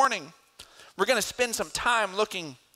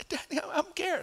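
put-on high, soft whimpering child voice; small frightened plea; scared little boy; 13 semitones higher, 5 dB quieter than usual. Son calling back to his father from the gate, scared whimper.

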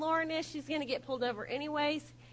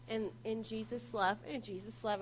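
soft, low, hesitant reminder; imitating a timid, placating passenger; meek correction; 3 semitones lower, 7 dB quieter than usual. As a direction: gently slip it in under the breath. Passenger meekly softens the threat.

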